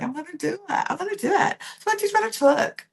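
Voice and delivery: high, mock British accent that slips; hammed-up British voice for Lucinda's eager line; bad British accent. Vlogger mimicking Lucinda's excitement in a bad British accent. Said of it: "very bad accent".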